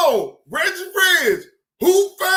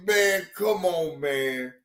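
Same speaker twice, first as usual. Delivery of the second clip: lower, dismissive come on; retold dismissal; 12 semitones lower, 3 dB quieter than usual. Telling Kev Lowe to quit it. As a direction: wave him off low. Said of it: "I say".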